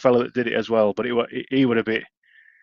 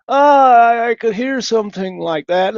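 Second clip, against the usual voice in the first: put-on much higher, louder American accent; 12 semitones higher, 5 dB louder, slower than usual. American caller guessing his accent, higher. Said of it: "imitate them ... American accent".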